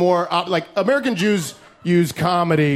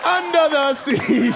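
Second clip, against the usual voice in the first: high, excited Haitian dad, badly done; dad's voice; 11 semitones higher than usual. Dad on the phone about Google, high excited bad impression. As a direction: squeal about Google like an old man discovering it. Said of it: "I can't do my dad's voice".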